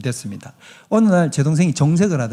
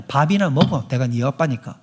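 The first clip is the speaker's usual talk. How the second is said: tender parent; 4 semitones lower than usual. Pretend. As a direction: say it gently as a real dad. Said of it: "진짜 부모는 뭐라고".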